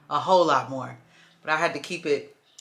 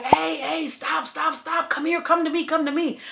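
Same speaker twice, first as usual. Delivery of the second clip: high mock coaxing, pitched way up; playing the "devil" luring her closer, voice raised high; coaxing devil guy; 11 semitones higher than usual. Boyfriend trying to calm her on the street, high mock coaxing. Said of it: "And he's like".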